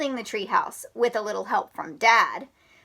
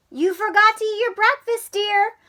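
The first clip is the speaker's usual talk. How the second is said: mom voice; 9 semitones higher than usual. Acted.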